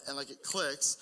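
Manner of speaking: normal talking voice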